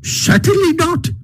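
very high, emphatic refusal without shouting; emphatic, defiant Toad. A narrator's reading. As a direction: flatly refuse in a squeaky-high voice. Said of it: "replied Toad emphatically".

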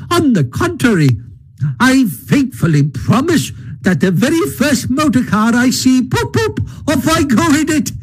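high, gleeful Toad voice; boastful Toad. A narrator's reading. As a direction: Toad crowing about the next motor car. Toad boasts about motor cars.

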